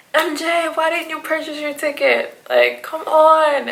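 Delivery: high, exasperated scolding of a friend; high fretful "why didn't you" to a friend; stressed friend. Retold or imitated. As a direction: whine it high with worry. He keeps scolding her.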